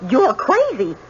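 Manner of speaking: re-enacted alarmed outcry, pitch climbing high; alarmed quote. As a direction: quote his panicked order, rising sharply to the end. Witness re-enacts man confronting knife-wielding woman.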